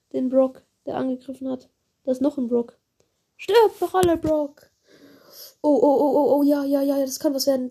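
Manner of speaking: normal talking voice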